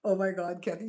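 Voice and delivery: ordinary talk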